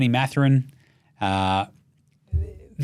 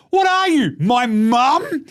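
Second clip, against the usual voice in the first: much higher and louder teasing; mocking jab; 10 semitones higher, 4 dB louder, slower than usual. Real, not acted. Host ribbing Jimmy Butler over cappuccinos, high loud mockery. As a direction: rib him like a mate.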